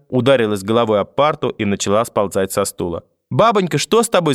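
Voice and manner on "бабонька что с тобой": put-on high, panicked child voice; alarmed cry. A narrator's reading. Grandson seeing grandmother collapse, crying out in alarm. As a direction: cry out in fright. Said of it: "закричал я".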